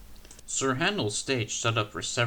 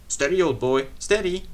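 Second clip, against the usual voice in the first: soothing driver; 4 semitones higher, 6 dB louder than usual. A narrator's reading. Driver calms engine.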